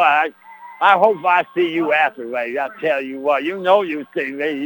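ordinary talk, nothing marked

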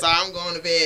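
drowsy, quiet mock yawn voice; sleepy voice. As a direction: sound half asleep. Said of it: "You call with a sleepy voice".

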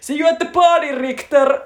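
high, loud mock action-hero shout; Arnold shout impression. Retelling the film, loud mock Arnold one-liner. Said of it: "se Arska huutaa vaan".

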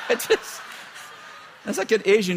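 giggling through the words; can't stop laughing at the joke; high laughing delivery. Real, not acted. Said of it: "I'm being funny".

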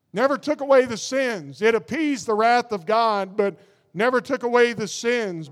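normal talking voice

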